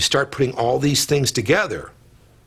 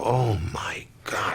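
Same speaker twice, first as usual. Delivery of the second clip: whispered; 5 dB quieter, slower than usual. Retold or imitated. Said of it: "You go".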